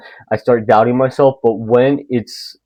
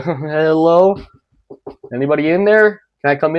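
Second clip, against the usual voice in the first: lightly higher "Hello?", a put-on nervous call; mock timid call; 5 semitones higher than usual. Someone knocking on the creepy apartment, in a lightly put-on timid call. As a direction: call into the door pretending to be brave. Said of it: "Just be like".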